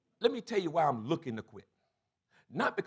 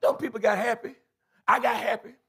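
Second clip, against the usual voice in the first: ordinary talk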